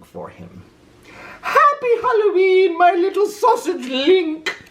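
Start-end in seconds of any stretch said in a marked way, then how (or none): 1.4-4.6 very high, sugary mother voice; doting mother. A narrator's reading. Mother greeting Oscar with his costume, high and gushing. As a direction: gush over him lovingly. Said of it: "said Oscar's mother".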